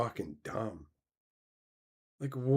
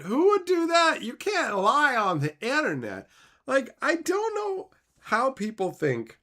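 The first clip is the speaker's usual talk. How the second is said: sarcastic disbelief; 11 semitones higher, 4 dB louder than usual. Real, not acted. Mocking people who believe internet lies, high sarcastic. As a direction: play dumb, dripping sarcasm.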